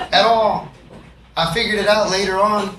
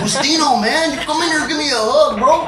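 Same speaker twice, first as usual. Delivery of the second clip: higher, put-on homeboy voice; gang friend voice; 6 semitones higher than usual. Gang friend welcomes him in a higher homeboy voice. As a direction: play the warm tough friend.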